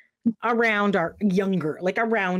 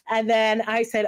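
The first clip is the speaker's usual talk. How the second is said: ordinary talk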